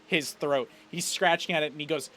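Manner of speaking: usual speaking voice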